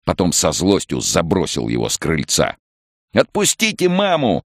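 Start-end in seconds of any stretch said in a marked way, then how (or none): 3.1-4.4 put-on very high, squeaky boy's shout; high-pitched desperate command; desperate child's yell. A narrator's reading. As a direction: demand it in a piping cry. Boy yelling at mother's attackers.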